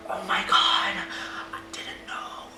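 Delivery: hushed, breathy mouthing of the words; barely mouthing it so nobody hears; whispered. Retold or imitated. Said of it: "I see him mouth the words".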